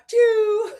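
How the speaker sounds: high, loud train-whistle hoot; mock phone alert sound; imitated choo-choo. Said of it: "you hear a choo choo".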